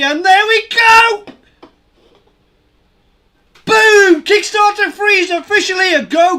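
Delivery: yelling for joy as the goal is smashed; excited shouting. Real, not acted. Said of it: "I'm sorry if I'm shouting".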